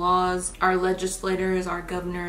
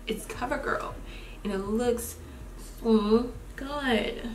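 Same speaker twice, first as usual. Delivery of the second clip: whisper; 3 semitones higher, 4 dB quieter, slower than usual. Real, not acted. Quiet aside about highlighter.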